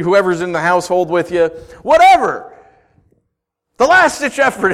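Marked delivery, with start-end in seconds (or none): 1.8-2.5 high, loud tossed-off shrug; dismissive whatever. Real, not acted. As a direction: wave it all away. Dismissing the list of steps.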